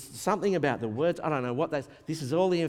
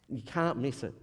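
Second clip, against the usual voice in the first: ordinary talk, nothing marked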